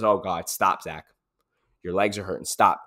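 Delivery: a hint of a pleading tired mind; mind begging to stop. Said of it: "your mind says".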